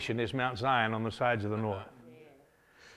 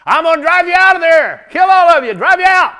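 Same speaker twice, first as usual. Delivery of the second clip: loud high defiant yell; defiant shout; 15 dB louder than usual. Acted. David shouting back at the laughing Jebusites. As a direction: shout it back, dead serious.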